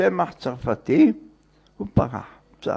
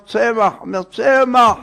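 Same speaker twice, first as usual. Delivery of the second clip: name called out, high and raised; retold shout; 7 semitones higher, 4 dB louder than usual. Visitor shouts his name at the door, retold shout. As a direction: call it louder the second time. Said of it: "וצעק".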